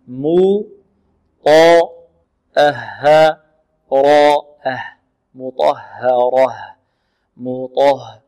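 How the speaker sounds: slow, drawn-out "مطهرة", thin م and ه, full heavy ط and ر; alternating light and heavy. Shown as a demonstration. Teacher drills the five letters, alternating light and heavy in "مطهرة". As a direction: chant "مطهرة" apart then joined, switching light, heavy, light, heavy. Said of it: "إذن مرقق، مفخم، مرقق، مفخم".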